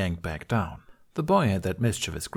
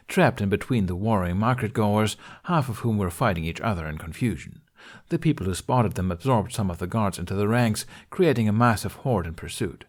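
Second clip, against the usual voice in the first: ordinary delivery, nothing marked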